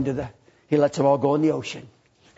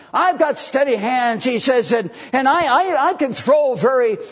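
higher, louder cocky bragging; 6 semitones higher, 4 dB louder than usual. Acted. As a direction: show off your steady hands. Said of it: "filled with confidence, and very cocky".

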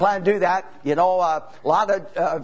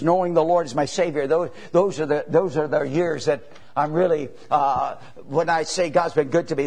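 ordinary talk

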